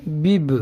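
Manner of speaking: بب read with the i vowel, bib; sounding out bib. Shown as a demonstration. Teacher shows how بب sounds with zer. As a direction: say بب as bib, clearly. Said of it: "تو اس طرح پڑھیں گے".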